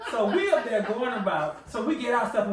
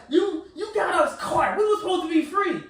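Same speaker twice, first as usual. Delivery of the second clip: high, indignant imitation of an accusation; mock-angry scolding; mad; 7 semitones higher than usual. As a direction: mimic her outraged complaint, pitched up. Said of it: "she was legit mad at me".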